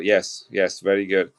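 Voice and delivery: normal talking voice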